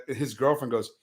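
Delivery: ordinary delivery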